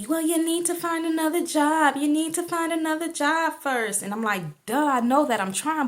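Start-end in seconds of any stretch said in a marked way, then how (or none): 0.1-4.0 loud, high-pitched mock scolding; mock nagging. Retelling someone scolding her about jobs. 4.7-5.9 loud, high "duh" retort; exasperated comeback. Retold or imitated. Retells her exasperated comeback.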